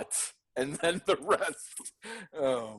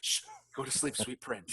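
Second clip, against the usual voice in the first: soft, hushed lullaby voice; whispering over a crib; 4 semitones lower, 10 dB quieter than usual. Pretend. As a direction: hush him gently to sleep. Mock tender death scene.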